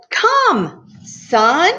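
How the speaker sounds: high summons, come sung out; calling out to creation. Acted. God summons the sun, high sing-out call.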